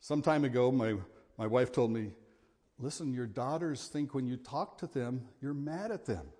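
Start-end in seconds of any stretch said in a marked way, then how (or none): none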